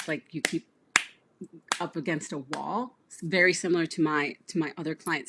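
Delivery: ordinary talk, nothing marked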